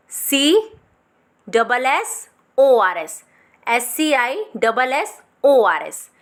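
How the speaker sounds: letters spelled out slowly one by one; slow spelling. Shown as a demonstration. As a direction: dictate each letter slowly. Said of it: "scissor की जो spelling होती है". (धीरे धीरे अक्षर बोलो)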